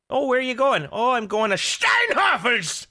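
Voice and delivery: high, exaggerated German accent; heavy German accent. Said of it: "you really have to say in a really heavy German accent".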